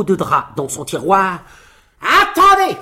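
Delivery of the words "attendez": exclamation forte, très aiguë; s'écrier en menaçant les mouches; cri indigné. A narrator's reading. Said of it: "s'écria-t-il". (cri aigu et menaçant)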